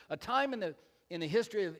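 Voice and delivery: ordinary talk, nothing marked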